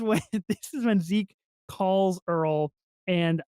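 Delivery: ordinary delivery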